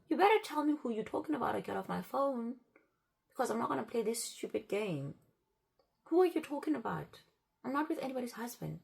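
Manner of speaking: furious at the caller on the phone; angry shout. Retold or imitated. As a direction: tell the caller off hard.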